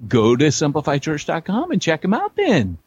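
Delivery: high mock infomercial call to action; hammed-up ad pitch. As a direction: push the website like a cheesy announcer. Acted sponsor pitch.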